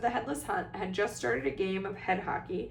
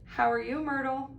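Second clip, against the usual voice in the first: higher, forced cheery greeting; falsely bright; 5 semitones higher than usual. A narrator's reading. Girl greeting the ghost she insulted, falsely bright. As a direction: cover up with fake brightness. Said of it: "in a falsely bright voice".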